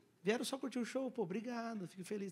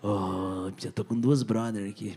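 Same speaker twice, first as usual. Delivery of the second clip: mock deep voice; 11 semitones lower, slower than usual. Voicing audience guy.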